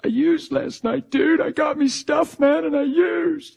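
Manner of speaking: high-pitched mock tearful confession. New believer confesses relapse to preacher, imitated crying. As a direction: imitate someone blubbering a slow confession. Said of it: "crying his eyes out".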